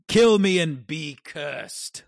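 slow, high, loud defiant curse; dramatic condemned lord. Retold or imitated. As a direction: declare it like a doomed man. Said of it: "Rickard Karstark's execution".

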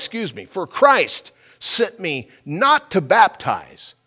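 slow, high, emphatic scripture proclamation; scripture reading. Acted. Preacher reading Paul's words to the congregation, slow and ringing scripture reading. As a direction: proclaim the verse loudly, drawing out the key words.

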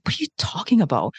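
whispered and quick, baffled; a bewildered whisper to the stranger; puzzled whisper. Retold or imitated. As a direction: hush it like you can't believe him. Savage confused by stranger's thanks.

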